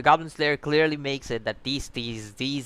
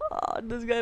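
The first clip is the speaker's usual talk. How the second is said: laughing; 11 semitones higher than usual. Real, not acted. Laughing at the anime scene.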